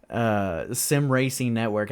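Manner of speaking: ordinary talk, nothing marked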